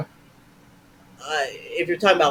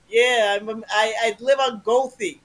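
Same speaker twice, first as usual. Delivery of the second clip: raised, hammed-up Chicago accent; Chicago guy; 5 semitones higher, 3 dB louder than usual. Improviser riffs on living on Gothy, Chicago-guy accent. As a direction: talk like a Chicago local. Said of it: "do Chicago guy".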